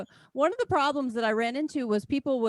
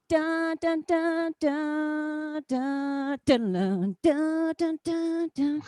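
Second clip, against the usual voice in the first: hummed ringtone; 6 semitones higher, much slower than usual. Retold or imitated. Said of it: "the iPhone standard one that's like".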